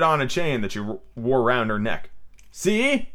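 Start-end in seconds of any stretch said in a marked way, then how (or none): 2.5-3.1 high, eager exclamation; excited discovery. A narrator's reading. Peter showing the boys the button, excitedly. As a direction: pipe up eagerly.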